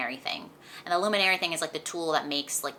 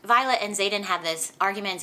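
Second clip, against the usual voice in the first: normal talking voice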